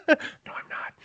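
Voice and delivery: unvoiced whispered confession. Real, not acted. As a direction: whisper it like a secret.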